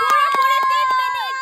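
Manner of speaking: loud, high announcement; excited shout. Real, not acted. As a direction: shout out the final time. Announcing the final time.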